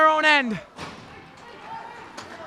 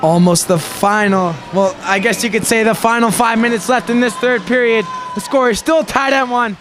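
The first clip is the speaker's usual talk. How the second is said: deep-ish excited hockey call; play-by-play; 4 semitones lower, 4 dB louder than usual. Acted. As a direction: call the game over the rink speakers. Live play-by-play.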